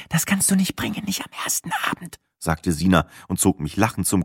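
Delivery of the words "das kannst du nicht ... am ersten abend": soft, high whisper; whispered protest. Acted. Sina whispers to her boyfriend, whispered protest. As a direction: hiss it under her breath.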